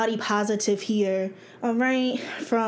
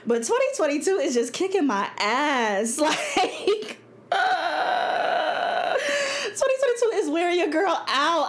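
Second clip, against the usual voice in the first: laughing through tears; 7 semitones higher than usual. Real, not acted. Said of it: "oh, I'm tearing up".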